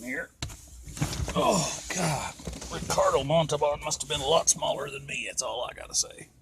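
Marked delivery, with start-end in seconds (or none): none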